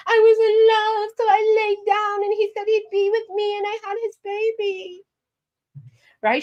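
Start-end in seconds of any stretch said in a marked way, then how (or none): 0.1-5.0 high sing-song mock of a lovesick girl; mocking naive girl. Vlogger mocking women who fall for men, high sing-song parody. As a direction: lampoon the dreamy lovestruck woman, singing it high. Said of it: "talking about".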